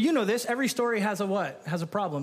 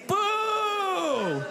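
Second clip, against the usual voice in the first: booing; 10 semitones higher than usual. Acted. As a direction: lead the crowd in a boo. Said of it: "thank you, thank you".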